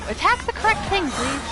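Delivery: usual speaking voice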